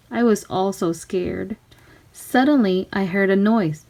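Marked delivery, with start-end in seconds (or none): none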